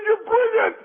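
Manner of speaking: a joke character thrilled by the plan; delighted exclaim. Acted. Drunk husband thanking his friend, high and delighted.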